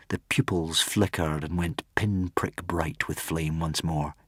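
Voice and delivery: ordinary delivery, nothing marked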